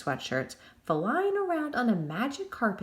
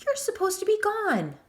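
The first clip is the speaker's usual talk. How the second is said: much higher, panicked protest; terrified; 13 semitones higher, 4 dB louder than usual. A narrator's reading. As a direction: plead with a ghost in terror. Deputy terrified of ghost.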